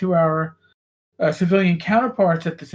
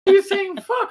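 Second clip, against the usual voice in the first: higher, mock-outraged mother voice; exasperated mom; 10 semitones higher, 4 dB louder than usual. Mother confronts her son at dinner, higher and outraged. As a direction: play the fed-up mom.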